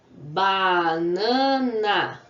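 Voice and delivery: "banana" very slow and loud, ba, na, na; teacher sounding out "banana" in three long beats; syllable by syllable. Shown as a demonstration. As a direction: stretch out each syllable of "banana". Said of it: "quantas vezes eu abro a boca".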